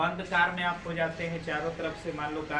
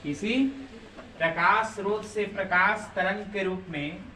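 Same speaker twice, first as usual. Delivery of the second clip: dictation. Acted. Teacher dictating notes slowly.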